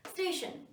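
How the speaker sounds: स्टेशन with a short half स straight into ट, no vowel before it; correct pronunciation. Shown as a demonstration. Teacher modelling station for students, said crisply. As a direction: start स्टेशन on a quick half स. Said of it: "स थोड़ा सा बोलना है".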